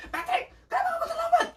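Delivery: hammed-up very high-pitched shriek of a question; mock furious squawk, far above normal pitch; high-intonation angry outburst. Shown as a demonstration. Contrasting how an angry person yells at a late Patrick, hammed-up outburst. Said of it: "Beda kan kalau".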